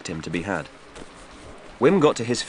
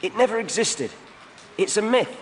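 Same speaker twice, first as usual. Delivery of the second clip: put-on much higher young man's voice; defiant youth; 13 semitones higher than usual. A narrator's reading. Wim taunts the Grunton thugs, high and defiant. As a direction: toss it back, cheeky.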